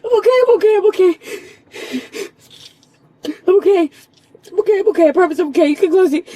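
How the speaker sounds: loud, breathless insisting; loud reassurance. Real, not acted. Reassuring the officer mid panic attack, loudly. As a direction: push past the panic to reassure.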